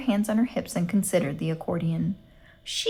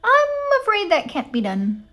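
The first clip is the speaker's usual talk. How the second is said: much higher, syrupy refusal; too sweet voice; 12 semitones higher, 5 dB louder than usual. A narrator's reading. Gladys turning Eugenia down on the phone, too sweet. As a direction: refuse with sugary politeness. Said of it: "in her too sweet voice".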